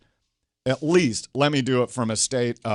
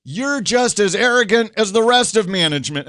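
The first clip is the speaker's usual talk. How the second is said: higher, louder accusing outburst; angry heckler; 8 semitones higher, 4 dB louder than usual. Retold or imitated. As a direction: hurl it at the speaker on stage. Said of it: "stands up and he goes".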